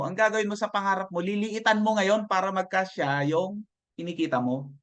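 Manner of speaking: ordinary talk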